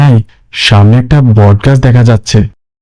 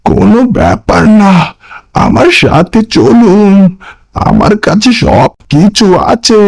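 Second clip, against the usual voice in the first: much higher, uncanny ghostly voice; eerie stranger; 9 semitones higher, slower than usual. Acted. Mysterious stranger greets the friends at the pyre, high and uncanny. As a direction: sound sly and otherworldly.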